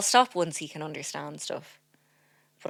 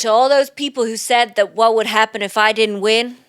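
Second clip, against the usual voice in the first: loud, higher, projected podium voice; mock acceptance speech; 5 semitones higher, 11 dB louder than usual. Comedian quoting an award winner's speech, loudly triumphant. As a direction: proclaim it to the whole awards hall.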